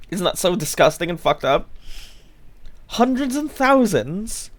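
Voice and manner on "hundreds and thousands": high, slow, disbelieving; incredulous repeat. Acted. Streamer mocks the British word for sprinkles, high and slow in disbelief. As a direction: drag it out in mock disbelief.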